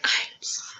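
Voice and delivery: tiny unvoiced mock whisper; acted-out hushed sorry; whispered apology. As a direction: whisper it in awkward shame. Retold awkward apology.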